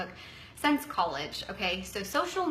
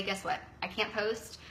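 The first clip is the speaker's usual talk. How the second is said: defeated; 3 semitones lower, 7 dB quieter than usual. Retold or imitated. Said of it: "call Christy and say".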